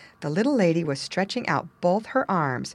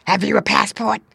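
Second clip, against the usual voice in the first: loud, higher rat voice; demanding water rat; 4 semitones higher, 7 dB louder than usual. A narrator's reading. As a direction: demand the papers harshly. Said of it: "asked the rat".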